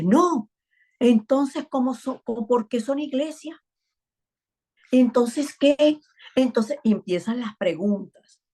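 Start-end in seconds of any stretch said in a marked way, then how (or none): 0.0-0.5 put-on child's no. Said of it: "me dice". 1.0-6.0 put-on high, eager little-boy questions; a child piping up with why after why; curious child questioning. Son questioning his mother, high and eager.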